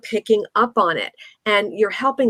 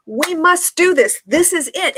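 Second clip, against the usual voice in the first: high-pitched, emphatic mock urgency; forceful insistence; 8 semitones higher than usual. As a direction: push each word as an over-intense demand. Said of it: "it's a mismatch".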